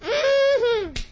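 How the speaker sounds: very high falsetto hums and whoops; hammed-up crowd shrieking encouragement; mock ecstatic congregation. Host imitates the audience during the speech, mock frenzied cheering. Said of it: "And they'd all go".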